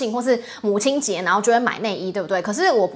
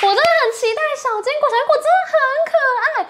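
much higher and fast, laughing a little; excited gushing; 14 semitones higher, faster than usual. Real, not acted. Aunt gushing to viewers about her nephew, excited.

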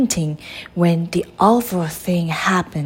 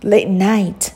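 usual speaking voice

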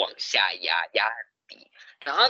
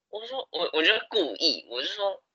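normal talking voice

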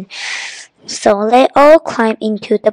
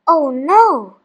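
drawn-out dismayed "oh", rising then falling; groaned; 4 semitones higher than usual. A narrator's reading. Kipper's friend on a tiny island, groaning in dismay. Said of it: "he groaned".

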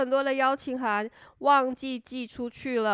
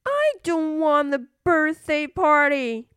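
put-on insistent, louder little girl; bossy little princess; 4 semitones higher, 4 dB louder than usual. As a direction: demand it like a spoiled princess. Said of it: "said the little princess".

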